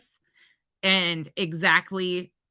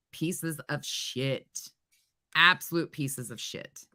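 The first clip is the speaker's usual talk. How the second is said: contemptuous mutter; 5 semitones lower, 5 dB quieter, slower than usual. Real, not acted. Contempt after backup channel.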